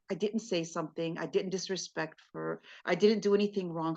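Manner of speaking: low, hushed thinking to himself; husband quietly protesting in his head; inner thought. Acted. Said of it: "In his mind, he's saying".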